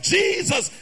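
usual speaking voice

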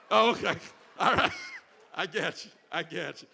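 loud laugh breaking into the words. Real, not acted. Preacher laughing loudly at a congregant's candy pick.

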